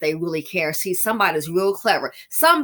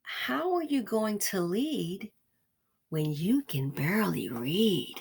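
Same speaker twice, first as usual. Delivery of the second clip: slow, soft, each word weighed; repeated punchline, slow and deliberate; emphatic repeat; 9 dB quieter, slower than usual. Shown as a demonstration. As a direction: spell it out slowly for the ones in the back. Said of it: "one more time for the ones in the back".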